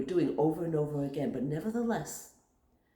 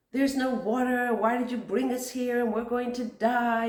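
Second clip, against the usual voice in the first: loud, higher complaining crowd; hammed-up people attacking Moshe; angry clamor; 5 semitones higher, 6 dB louder than usual. The people clamoring at Moshe, mock angry. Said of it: "in a very, very rough, angry manner".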